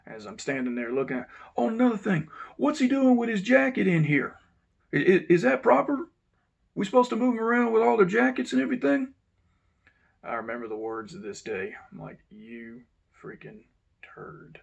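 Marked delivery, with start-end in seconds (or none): 1.6-9.1 loud, high, fault-finding boss voice; nitpicking warden. Retold or imitated. Warden scolding over jacket. 12.3-14.6 slow, soft whispered curse; under-the-breath insult. Retold or imitated.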